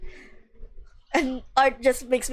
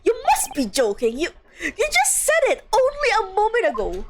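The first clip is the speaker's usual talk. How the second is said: incredulous protest; 8 semitones higher than usual. Acted. Acted disbelief in game dialogue.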